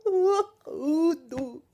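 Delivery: imitating a creepy man's laughing coo; creepy Ezra. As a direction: tease the creepy teacher with a laughing ooh. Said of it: "and he's like".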